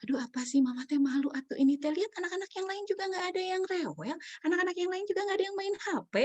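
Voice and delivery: high-pitched, fretful mom voice; embarrassed mother. Retold or imitated. Mother comparing child to others, mock embarrassed nagging. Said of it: "ibu-ibu sering fokus pada situasinya".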